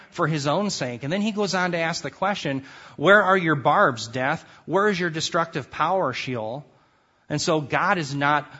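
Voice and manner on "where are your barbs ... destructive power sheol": mock death's power from the heights of victory; taunting. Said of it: "He's taunting them".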